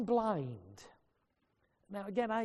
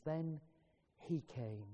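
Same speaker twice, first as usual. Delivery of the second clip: zacht en laag: "Then he came" als een stille onthulling; hushed refrain; 4 semitones lower, 9 dB quieter, slower than usual. Acted. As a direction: laat "Then he came" bijna fluisterend wegzakken.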